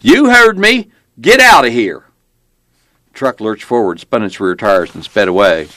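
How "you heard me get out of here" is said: loud, high, commanding shout; armed cop's threat. A narrator's reading. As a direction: bellow a final warning.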